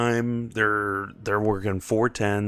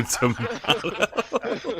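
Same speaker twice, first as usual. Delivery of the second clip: tomato drawn out slowly through laughter; laughing tomato; 10 semitones higher, much slower than usual. Pretend. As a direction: stretch "tomato" long while cracking up. Repeats tomato joke laughing.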